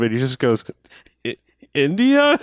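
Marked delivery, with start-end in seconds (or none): 1.3-2.4 halting on "I-", then a high questioning "India?"; hesitate, then lift "India?" up high as a question; comedic pause. Retold or imitated. Retelling an actor's movie joke to a co-host, comedic pause. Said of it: "it's this great comedic pause".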